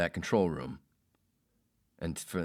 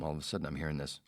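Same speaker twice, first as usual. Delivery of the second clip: ordinary delivery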